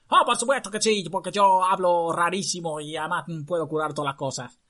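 high, loud clown doctor voice. Acted. Disguised girl boasts to court as clown doctor. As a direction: play a funny foreign doctor. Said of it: "Aunque hablaba con esta voz".

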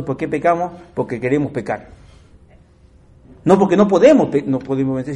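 ordinary talk

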